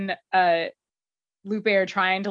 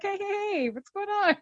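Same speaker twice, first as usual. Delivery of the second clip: mock phone greeting; 13 semitones higher, 3 dB quieter than usual. Hardison answering Parker's call.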